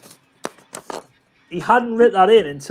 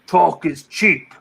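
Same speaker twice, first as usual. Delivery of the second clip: Mister Miyagi line; much slower than usual. Retold or imitated. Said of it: "he gets him and goes".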